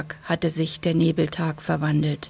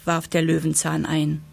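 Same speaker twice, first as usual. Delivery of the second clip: ordinary delivery, nothing marked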